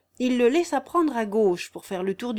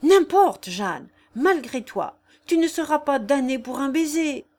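loud and higher, forceful; booming voice; 6 semitones higher, 6 dB louder than usual. Acted. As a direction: ring out with energy. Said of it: "voix retentissante ... avec énergie".

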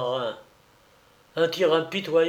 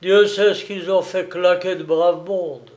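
a hint of the old seaman, louder; lightly voiced angry old sailor; 4 semitones higher, 7 dB louder than usual. A narrator's reading. Old sailor lamenting the drowned crews, angry. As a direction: grumble over the dead like an old salt.

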